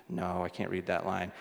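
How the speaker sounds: sigh, then a low, soft admission; pressured child. Retold or imitated. Dad retelling son's failed eye test, mildly pressured. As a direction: imitate a child quietly giving up. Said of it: "starting to feel a little bit of pressure".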